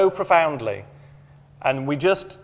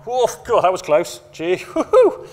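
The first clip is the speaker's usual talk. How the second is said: hammed-up, much higher gasps of relief, laughing; mock relief; 6 semitones higher, 5 dB louder than usual. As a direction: send up a colleague's giddy near-miss relief. Mocking staff filling near-miss forms.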